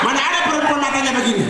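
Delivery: mocking shout. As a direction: ridicule the sweet talk. Mengejek rayuan gombal. (teriakan mengejek yang cepat)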